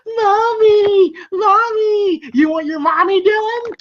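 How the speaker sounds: villain mimicking a child's wail for mommy; mocking taunt. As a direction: taunt the kid by mimicking her cries. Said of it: "you hear Freddy taunting".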